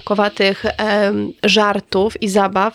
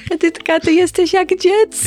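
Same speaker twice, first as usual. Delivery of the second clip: lightly mocking, higher voice of her partner; imitating partner; 9 semitones higher than usual. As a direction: tease him by playing his line back. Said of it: "ty patrzysz się na mnie i mówisz". (przedrzeźniając partnera, wyżej i z przekąsem)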